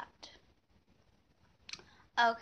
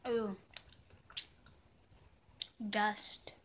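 talking around candy in mouth; chewing gum; 9 dB quieter, slower than usual. Real, not acted. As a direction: mouth partly full. Seen 2: green piece enters mouth at -7, still between lips at +12.

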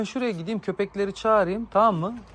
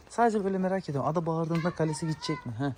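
normal talking voice

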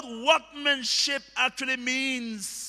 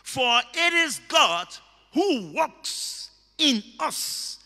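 emphatic, building sermon refrain; ringing pulpit declaration; full-force preaching; 5 dB louder than usual. Real, not acted. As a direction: preach it out, hammering each parallel phrase.

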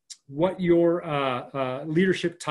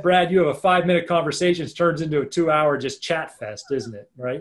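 ordinary talk, nothing marked

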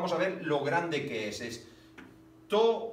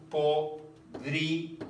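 slow syllable reading; much slower than usual. Shown as a demonstration. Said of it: "Una, dos".

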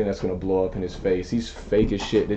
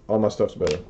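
ordinary delivery

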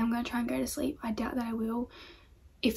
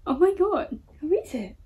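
high, playful pet-talk voice; excited dog talk; 7 semitones higher, 5 dB louder than usual. Real, not acted. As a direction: tease your puppy in a high coo. Teasing dog about his reflection.

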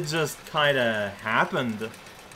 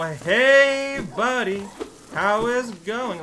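much higher and louder, bright and eager; "Hey" jumps high and loud, then a chirpy "buddy, how is it going?"; cheery greeting; 8 semitones higher, 5 dB louder, slower than usual. Pretend. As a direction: greet the poor bug like an old friend. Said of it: "And you go".

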